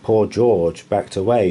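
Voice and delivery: ordinary delivery, nothing marked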